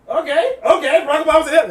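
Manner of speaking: high-pitched, joking eagerness; mock excited claim. Host joking after his cash app hit, mock excited. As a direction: blurt it out in pretend enthusiasm.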